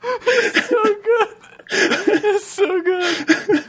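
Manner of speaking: high, slow words through laughter; crying with laughter; laughing. Real, not acted. Laughing hard at the joke.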